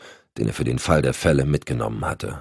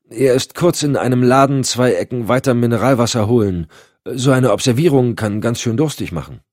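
raised, overly eager excuse; covering for his partner; nervous Randall lying; 8 semitones higher, 5 dB louder than usual. Acted. Randall lügt Cotton an. (nervös schwindelnd)

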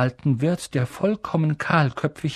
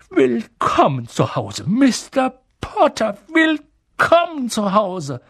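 put-on high-pitched, emotional old barman; tearfully moved; 9 semitones higher, 4 dB louder than usual. A narrator's reading. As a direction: welcome an honoured guest with rising emotion. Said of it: "mit Tränen in den Augen".

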